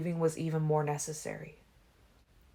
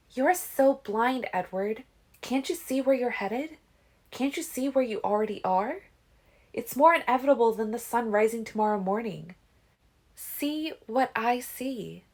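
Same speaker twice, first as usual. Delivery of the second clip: insistent Alice; 8 semitones higher, 3 dB louder than usual. A narrator's reading. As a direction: make him see it.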